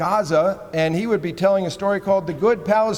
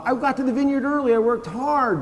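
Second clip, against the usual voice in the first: high and fast, rushed pleading; whining plea; 8 semitones higher than usual. Retold or imitated. Preacher pleading to Saint Peter about vineyard work, high and rushed. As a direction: rattle off excuses up high.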